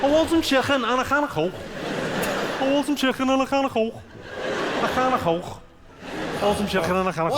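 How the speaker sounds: high, throat-scraping Scouse; Scouse accent. Shown as a demonstration. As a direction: drill a hammed-up Scouse catchphrase. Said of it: "the phrase I use to do the Scouse accent".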